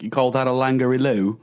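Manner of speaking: put-on a Crocodile Dundee sneer in a silly Australian accent; Crocodile Dundee impression. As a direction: ham up a mock Australian bushman accent. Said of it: "Crocodile Dundee".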